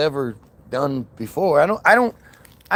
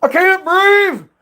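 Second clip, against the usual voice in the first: high, loud hammed-up yell; mock cry of distress; 11 semitones higher, 4 dB louder, slower than usual. Crying out for air, high hammed-up yell. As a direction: cry it out high, stretching the last word.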